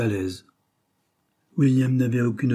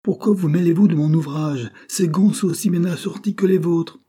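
higher, much louder furious demand; angry cry; 9 semitones higher, 6 dB louder than usual. A narrator's reading. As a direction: snap at him in outrage. Cyril rages at William.

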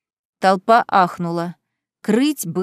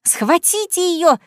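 shrill scream; 8 semitones higher, slower than usual. Acted. Beatrice urging the crowd to seize Kaitlyn, shrill slow scream. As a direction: screech the order at the guards. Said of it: "визгливо закричала Беатрис".

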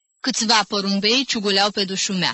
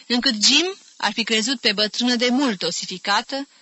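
ordinary delivery, nothing marked

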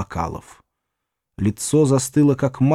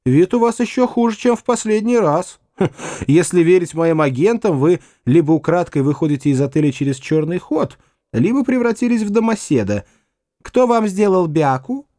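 насмешливый Гудвин; 6 semitones higher than usual. Acted. Гудвин язвит, голос выше.

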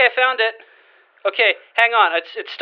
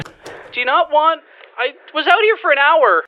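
higher, louder, wounded objection; put-out protest; 7 semitones higher, 3 dB louder than usual. Acted. Intern objecting to the hosts after an hour out, put-out protest. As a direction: protest as if your effort was wasted.

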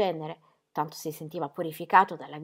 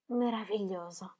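soft dreamy echo; 2 semitones higher, 7 dB quieter than usual. Acted. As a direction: repeat "meraviglioso" softer, fading out. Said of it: "trattenne un profondo respiro". ("meraviglioso" ripetuto piano, in dissolvenza)

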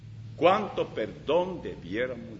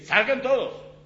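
high, loud order to clear the room; a master sending everyone out, loudly; commanding shout; 9 semitones higher, 8 dB louder than usual. Retold or imitated. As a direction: bark the order at the servants.

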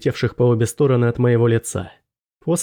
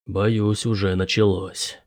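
low, subdued professor; apprehensive professor; 5 semitones lower, 4 dB quieter, slower than usual. A narrator's reading. Professor fears it has begun, low and understated. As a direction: break it to them low and slowly.

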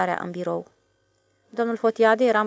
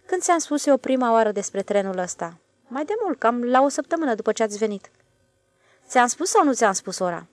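lightly raised, louder voice of a self-assured stationmaster; a boss pressing his point; stationmaster's voice; 4 semitones higher, 5 dB louder than usual. A narrator's reading. The stationmaster quizzes the switchman, lightly voiced.

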